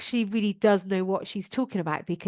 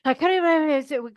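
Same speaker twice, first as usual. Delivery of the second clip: nervous; 5 semitones higher, 4 dB louder than usual. Retold or imitated. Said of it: "If I reacted from nervous".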